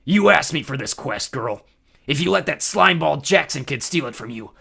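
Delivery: loud menacing war god; threatening. A narrator's reading. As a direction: threaten your daughter. Ares threatening.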